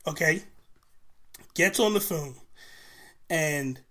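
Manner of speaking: normal talking voice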